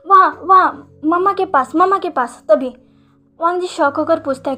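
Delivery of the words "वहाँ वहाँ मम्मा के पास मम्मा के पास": put-on higher and louder excited child; eager insisting. A narrator's reading. Boy tells his father to sleep beside mother, loud eager insisting. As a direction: point and insist, over there.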